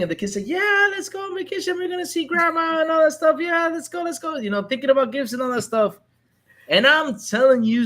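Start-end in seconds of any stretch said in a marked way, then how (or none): 0.5-4.4 put-on high, excited kid voice, squealing with joy. Father tells kid about trip, kid answers high and excited. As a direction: mimic a thrilled kid bouncing at the news.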